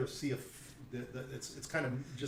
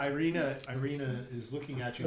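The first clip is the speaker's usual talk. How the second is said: usual speaking voice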